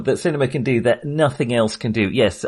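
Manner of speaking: ordinary talk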